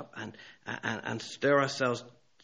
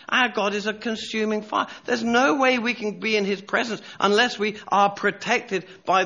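high, emphatic proclamation with weighty pauses; impassioned preaching; 9 semitones higher, 5 dB louder than usual. Real, not acted. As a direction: proclaim it fervently to the congregation.

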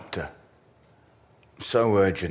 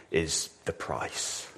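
whispered aside; 3 semitones lower, 7 dB quieter, slower than usual. Shown as a demonstration. Preacher confiding the real worry about the menu. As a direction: whisper is the price, slowly. Restaurant price secret.

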